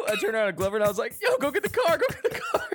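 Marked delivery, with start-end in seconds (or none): 1.2-2.6 urgent, high-pitched retold plea; mock panic. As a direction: imitate frantic begging, voice shooting up into a laugh.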